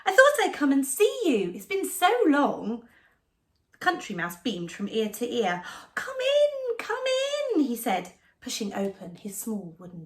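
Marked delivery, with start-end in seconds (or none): 0.0-2.9 lightly squeaky mouse greeting, louder; cheerful little mouse voice; squeaky Town Mouse. Acted. Town Mouse greets his friend. 5.9-7.6 higher, eager mouse welcome; a hint of a squeaky mouse; welcoming mouse. Country Mouse welcomes friend.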